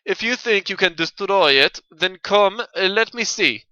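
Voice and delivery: put-on loud, impatient North European accent. Witness hurrying the accused, impatient North European accent. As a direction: challenge them loudly in a North European accent. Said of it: "supposed to be North European".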